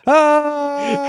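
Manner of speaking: high, sustained mock scream; fake yell. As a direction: imitate a badly acted yell. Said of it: "it's like fake yell".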